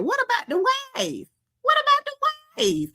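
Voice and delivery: very high, put-on little voice; high-pitched mock plea; squeaky falsetto catchphrase. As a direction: sing it out way up in falsetto.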